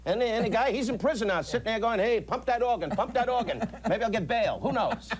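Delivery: a tough-guy dockworker act; brash street talk, in a high voice; cocky wise guy, pitched high. Acted. Playing a cocky wise guy to the caller Eddie.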